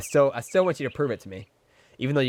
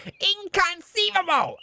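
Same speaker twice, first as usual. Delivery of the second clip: very high, drawn-out exclamation; put-on "inconceivable" voice; 3 dB louder, slower than usual. Streamer mimics Kirka's inconceivable accent, high drawn-out cry. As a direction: stretch it out in a squeaky put-on accent. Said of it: "Kirka has an inconceivable accent".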